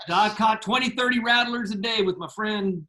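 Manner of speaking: imitating a bragging rattlesnake catcher; Big Tom. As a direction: boast like an old hand with snakes, pitch up. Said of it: "That sounds like Big Tom".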